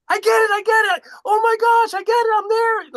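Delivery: very high, loud excited shout; ecstatic realization. Retold or imitated. Woman in the ocean finally getting it, elated mock shout. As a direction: shout it like a sudden breakthrough, imitated. Retold breakthrough shouted in the water.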